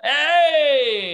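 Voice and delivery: high, loud celebratory whoop; real cheer. Real, not acted. Listener celebrating his friend winning the cab, real cheer. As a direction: cheer your friend on, drawn out.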